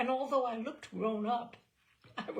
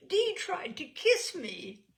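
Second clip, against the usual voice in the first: higher and louder, slow, aghast; mock-horrified confession, slowed; shocked girlish outburst; 10 semitones higher, 8 dB louder, slower than usual. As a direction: blurt it out to your friend, scandalised. Telling friend, shocked.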